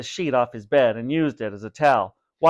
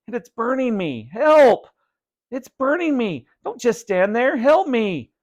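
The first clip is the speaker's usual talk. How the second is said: high loud cries for help; desperate screaming; 10 semitones higher, 5 dB louder than usual. A narrator's reading. Vinny burning, screaming at his brother for help. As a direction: beg for help in panic.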